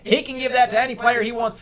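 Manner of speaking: ordinary talk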